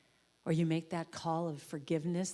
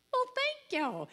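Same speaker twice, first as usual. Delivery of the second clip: high-pitched, touched surprise; delighted thanks; slower than usual. Retold or imitated. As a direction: gush with grateful surprise, voice leaping high. Delighted reaction to free soup.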